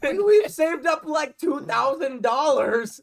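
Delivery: high, loud, slowed by laughter; laughing insistence. Real, not acted.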